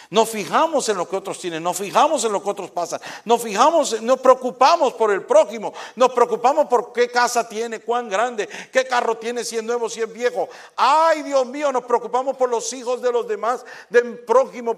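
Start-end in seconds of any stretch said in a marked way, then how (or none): 3.3-14.8 higher, louder preacher's build; fiery preaching. Acted. Pastor warning the congregation about envy, full-force preaching. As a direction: hammer each phrase from the pulpit.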